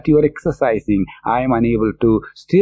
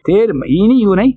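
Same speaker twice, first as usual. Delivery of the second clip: rapid verse recital; 4 semitones higher, much faster than usual. Retold or imitated. Reciting the saint's verse to the congregation, fast chanted. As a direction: rattle off the Tamil line கெடக்கிடந்தனை-style, fast and a bit higher. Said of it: "So he says".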